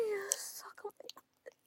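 high whispered plea with a cry; pleading whisper. Acted. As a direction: beg breathlessly in a whisper.

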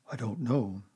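ordinary delivery

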